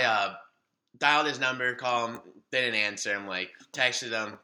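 usual speaking voice

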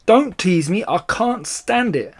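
high, pleading protest; loud outburst slowing into a wail; anguished cry. A narrator's reading. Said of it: "cried Badger".

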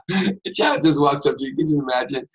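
amused, laughing tone. Real, not acted. Preacher amused picturing the child, laughing. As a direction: keep the laugh in the voice.

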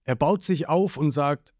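plain reading voice

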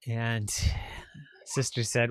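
usual speaking voice

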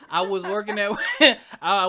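words through laughter; can't stop laughing mid-story; laughing while talking. Real, not acted. Laughing as he starts his Walmart story.

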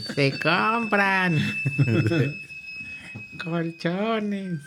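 slow, high drawn-out street vendor call; crackly looped vendor recording. Retold or imitated. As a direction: stretch it like the old loudspeaker ad. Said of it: "nomás falta ahorita el". (grabación de vendedor ambulante)

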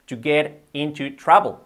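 "to get into trouble" slow, word by word; careful, drawn-out "to get into trouble"; slow model phrase. Shown as a demonstration. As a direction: space out "to get into trouble" for learners. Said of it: "Di-, dicho rápido".

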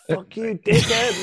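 high-pitched indignant yell, climbing sharply; hammed-up outraged comeback; retold angry outburst. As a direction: fire back at an insult, voice leaping up. Acts out snapping back at passerby.

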